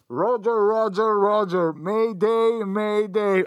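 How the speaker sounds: high radio pilot call; over a radio. Pretend.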